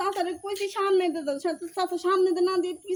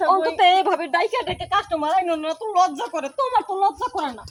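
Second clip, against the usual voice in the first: loud, raised-pitch reproach; indignant scolding; 3 semitones higher, 7 dB louder than usual. Acted. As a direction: shame him angrily. Wife scolds husband for shouting offers. (রেগে গলা চড়িয়ে ধমক)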